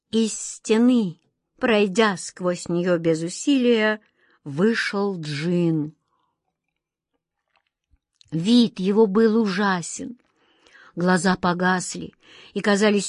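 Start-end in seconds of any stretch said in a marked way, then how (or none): none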